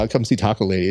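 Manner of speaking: ordinary delivery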